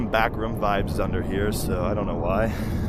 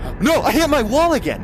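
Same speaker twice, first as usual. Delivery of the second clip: very high, loud wail; anguished yell; 8 dB louder than usual. Real, not acted. VR player hitting the wall, anguished yell. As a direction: wail in real frustration. Bumps wall a second time.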